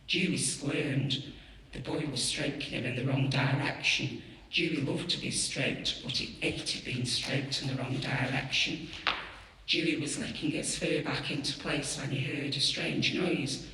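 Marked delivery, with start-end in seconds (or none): none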